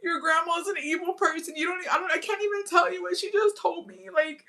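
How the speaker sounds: high, breathless fake hurt; mock wounded victim. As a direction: play the wronged pregnant wife. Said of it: "I'm like".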